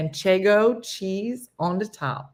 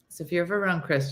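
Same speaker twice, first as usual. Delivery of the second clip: ordinary talk, nothing marked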